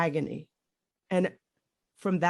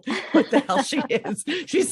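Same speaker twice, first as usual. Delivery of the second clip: laughing through the words, a bit louder and higher; 7 semitones higher, 5 dB louder than usual. Real, not acted. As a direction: burst out laughing at not being able to name it. Laughing at her own confusion.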